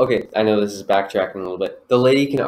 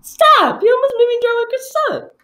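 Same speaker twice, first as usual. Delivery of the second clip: high, loud shriek of stop; shrieking scold; 7 dB louder than usual. Acted. Friends improvising a scolding skit, squeaky loud shriek.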